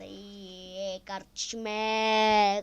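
loud, slow drawn-out cheer. Real, not acted. Gamer cheering slowly at a win.

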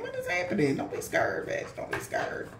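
unvoiced, taunting whisper; eerie mock comfort, all breath. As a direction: hiss it softly to scare the attacker.

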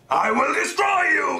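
evil villain laugh. Acted.

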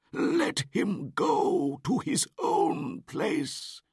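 high, loud, slow plea; pleading old wolf. Acted. Akela pleading to the pack for Mowgli, slow. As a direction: plead each point slowly.

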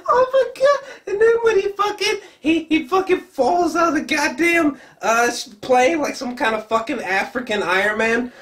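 very high, laughing shriek of joy; screaming with excitement. Real, not acted. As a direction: squeal like the best moment just hit. Erupting at trailer highlight.

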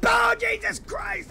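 high, drawn-out loud exclamation; startled shout. Real, not acted. Gamer reacting to a good shot, startled shout.

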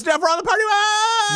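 high, drawn-up party cry; mock excited shout. Host boarding the party bus with Jeff, hammed-up excited shout. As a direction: whoop it up in pretend excitement.

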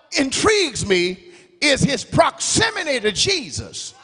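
ordinary delivery

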